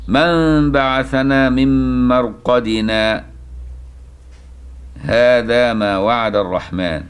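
very slow recitation with a stop after "مرقدنا"; Quranic recitation. Shown as a demonstration. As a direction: recite slowly, pause on "مرقدنا". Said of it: "يستحب الوقف".

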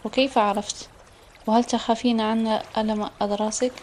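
plain reading voice

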